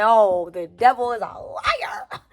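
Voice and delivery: slow, drawn-out declaration, stretching the last word; church-style outcry, dragged out; dramatic exclamation. Retold or imitated. Storyteller reliving getting out of that car, dramatic drawn-out outcry.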